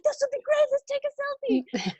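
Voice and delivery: very high, bubbly pitch; mock excited. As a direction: pitch the selfie like a thrilled kid. Eager suggestion to the nuns.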